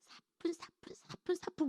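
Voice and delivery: very soft, airy imitation of light steps; dainty tiptoe whisper. Describing the pretty colleague's gait, dainty whispered imitation. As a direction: whisper each light step delicately.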